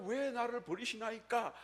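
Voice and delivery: high, drawn-out retold cry, without added volume; 부르짖음 재연. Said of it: "라고 소리치면서". (높은 음으로 길게 부르짖는 흉내)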